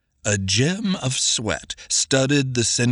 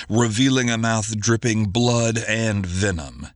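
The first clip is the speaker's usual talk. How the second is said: ordinary delivery, nothing marked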